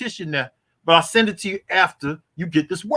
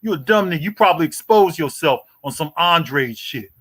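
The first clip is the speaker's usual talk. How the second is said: ordinary talk, nothing marked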